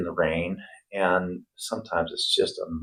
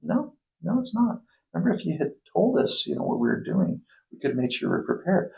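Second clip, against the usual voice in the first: much higher, protesting no no; mock pleading, pitched way up; exasperated retort; 10 semitones higher, 3 dB quieter than usual. Plumber arguing back at his cousin, high exasperated protest. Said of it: "I go".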